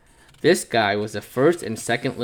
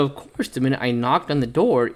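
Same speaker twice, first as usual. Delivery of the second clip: ordinary delivery, nothing marked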